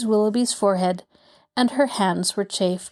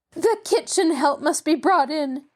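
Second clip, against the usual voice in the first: higher, firm insistence on fetching the kitchen help; insisting; 7 semitones higher than usual. A narrator's reading. Mrs Willoughby to the manager, insisting. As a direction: demand it like a rich lady who won't be refused. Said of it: "Missus Willoughby insisted".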